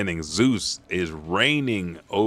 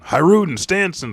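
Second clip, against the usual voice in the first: higher, louder called-out name; acted yell for someone; 10 semitones higher, 7 dB louder than usual. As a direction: call the name out from afar. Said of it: "someone yells".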